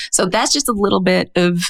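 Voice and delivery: ordinary talk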